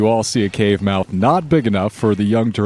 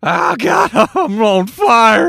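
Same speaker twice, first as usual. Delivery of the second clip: burning scream; 13 semitones higher, 6 dB louder than usual. Acted. NPC burning alive, screaming.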